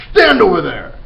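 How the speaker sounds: loud high command; barked order. Acted. Headmistress ordering the boy to one side, loud. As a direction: order him aside sharply. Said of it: "the Trunchbull ordered".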